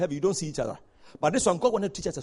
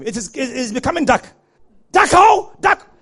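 higher, louder cries of alarm; retold screaming; 7 semitones higher, 9 dB louder than usual. Dying atheist watching the dark close in, screaming. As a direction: cry out as a dying man losing the light. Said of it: "continuing to scream".

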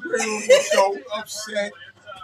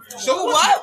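defiant shout; 12 semitones higher, 7 dB louder than usual. Real, not acted. Emphatic dismissal.

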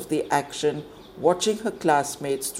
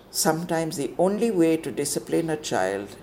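ordinary delivery

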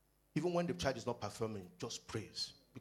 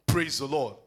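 ordinary talk, nothing marked

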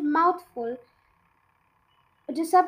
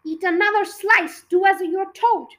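higher, louder bullying order; sharp command; 5 semitones higher, 7 dB louder than usual. A narrator's reading. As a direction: bark the order at the boy. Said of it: "sharper edge to her voice".

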